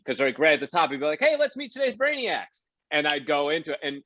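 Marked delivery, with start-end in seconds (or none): 1.2-2.6 bright, high host announcing; game show host voice. Retold or imitated. Host greeting the studio crowd at the top.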